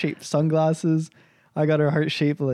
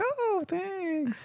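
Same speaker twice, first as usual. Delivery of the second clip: imitated fake thanks, high and soft; insincere thanks; 9 dB quieter than usual. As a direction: mimic mom's hollow thank-you, pitched way up. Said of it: "She was not genuine and, like, cold-hearted".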